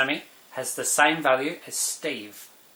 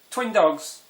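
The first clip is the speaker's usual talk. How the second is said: excited reveal; 7 semitones higher, 4 dB louder than usual. Real, not acted. Punchline reveal of twin dogs.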